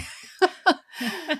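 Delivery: a high, loud burst of laughing; burst of laughter. Real, not acted. Laughing at clients who dread turning sixty, loud burst of laughter.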